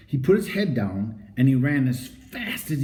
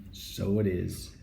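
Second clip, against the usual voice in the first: soft echo; 6 semitones lower, 8 dB quieter, slower than usual. A narrator's reading. Man repeats to himself softly.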